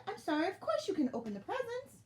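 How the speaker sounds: high, soothing elf voice; apologetic consoling. A narrator's reading. Elf comforting crying friends, apologetic and high. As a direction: relent and reassure, pitched well up.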